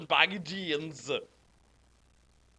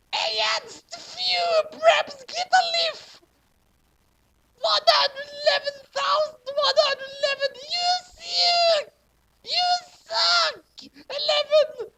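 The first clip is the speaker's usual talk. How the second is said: put-on much higher, squeaky teen girl voice; ditzy author voice; 6 dB louder than usual. The fanfic author addressing her readers, squeaky teen girl. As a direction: read it like a giddy clueless teen writer.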